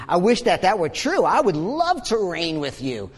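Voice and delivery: mock-wishful, with swooping pitch; sarcastic. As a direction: gush with pretend admiration. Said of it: "He says sarcastically".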